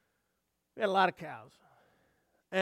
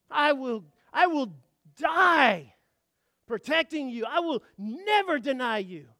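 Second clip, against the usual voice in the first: forceful pledge peaking loud on die; emphatic vow; 4 semitones higher, 8 dB louder than usual. Acted. Peter swearing to die protecting Jesus, emphatic vow. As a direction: swear it boldly, punching each phrase.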